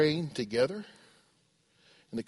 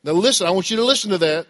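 ordinary talk

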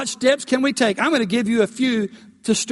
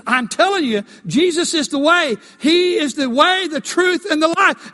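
insistent preaching; 7 semitones higher, 3 dB louder than usual. Retold or imitated. New convert preaching to attacker.

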